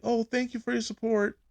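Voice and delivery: put-on high, sweet girl voice; Girl Scout thanking a buyer; sweet kid thanks. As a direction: chirp it politely. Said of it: "they're like".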